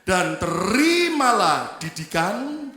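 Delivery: slow, drawn-out reading of the verse; scripture reading. Acted. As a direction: lead the congregation in reading. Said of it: "Yuk baca sama-sama".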